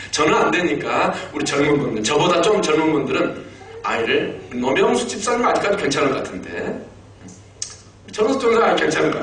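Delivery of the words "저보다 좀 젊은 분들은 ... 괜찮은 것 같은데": ordinary delivery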